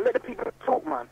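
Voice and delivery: usual speaking voice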